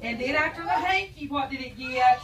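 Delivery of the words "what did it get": ordinary delivery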